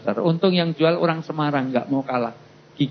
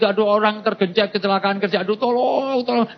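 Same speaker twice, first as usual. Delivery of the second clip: mock cry for help; 7 semitones higher than usual. Crash victim pleading for rescue, high mock-desperate cry. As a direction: wail for help like a comically trapped victim.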